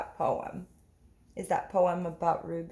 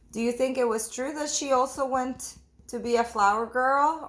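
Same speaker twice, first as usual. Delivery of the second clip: usual speaking voice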